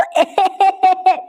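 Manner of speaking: high, hammed-up cackle; put-on laugh. Master explaining the money trick, mock high cackle.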